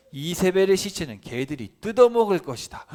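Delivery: thundering prophet's curse; doom prophecy. Acted. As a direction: proclaim the curse with force. Dire prophecy against Jezebel.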